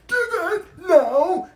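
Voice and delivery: slow, high, exaggerated teacher voice, laughing; mocking the teacher. Student mimicking the teacher's order, slow and high. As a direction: mimic her order in a whiny, stretched-out way. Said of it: "she came over to my desk and she said".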